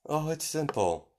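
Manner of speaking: high, lilting character voice; airy villain explanation. Acted. Villain explaining to Kagura, in high sing-song. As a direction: pitch it high and make it sound easy.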